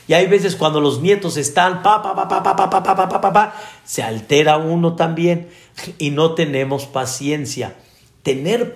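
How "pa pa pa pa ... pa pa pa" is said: imitated child racket. Grandchildren making noise.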